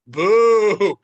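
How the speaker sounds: loud, long drawn-out boo; heckler's boo. Retold or imitated. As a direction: imitate a drunk crowd heckle. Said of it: "easiest heckle in the world".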